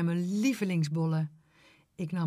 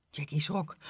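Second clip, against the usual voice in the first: usual speaking voice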